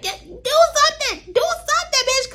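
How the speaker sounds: mock-frantic begging in a high squeal; retold screaming. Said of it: "I just kept screaming Chelsea name".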